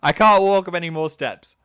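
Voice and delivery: loud, high whiny protest; mock strop. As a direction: act out a tantrum of giving up. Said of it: "I had a bit of a strop".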